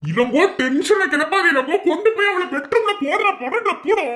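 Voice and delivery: very high, agitated scolding; tense outburst climbing in pitch; exasperated orders. Acted. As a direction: lose patience and order him off. Exasperated orders, tension rising.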